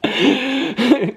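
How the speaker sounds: high, squeaky laugh through the words; laughing helplessly. Real, not acted. Admitting a failed accent attempt, laughing hard. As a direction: crack up at your own failure. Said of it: "I can't do an Australian accent".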